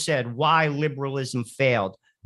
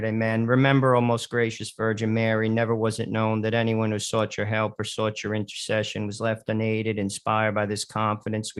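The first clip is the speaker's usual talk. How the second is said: soft, measured prayer voice, growing softer toward the end; 5 semitones lower, 4 dB quieter than usual. Acted. Radio host leading listeners in the Memorare, prayerful. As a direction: pray it reverently, steadily.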